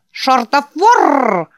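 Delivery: slow, high, loud buzzing insect screech; housefly. Acted. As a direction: rasp it out like a giant buzzing bug. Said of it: "проскрипел он своим резким жужжащим голосом".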